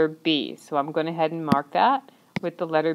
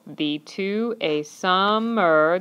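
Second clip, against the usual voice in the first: "thee to a summer" at half speed, sum-mer split; syllable by syllable; much slower than usual. Shown as a demonstration. Teacher shows the two syllables, slow and separated. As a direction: count it out slowly, one syllable at a time. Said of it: "there's my two syllables".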